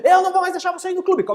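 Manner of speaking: high, loud screaming mom; furious mother yelling. Acted. Mother yelling threats at her screaming son. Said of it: "Mais ou menos desse jeito".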